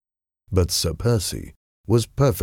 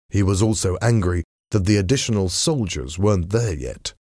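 ordinary delivery, nothing marked

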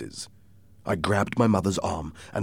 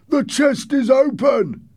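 high, loud, drawn-out yell; man's shout; 7 dB louder, slower than usual. Acted. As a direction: shout it down from the window. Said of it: "one man shouted from the window".

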